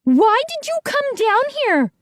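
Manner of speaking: high, spat-out words; angry demand. A narrator's reading. Missus B scolding the kids, spitting angry. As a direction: spit each word in anger. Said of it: "spitting the words angrily".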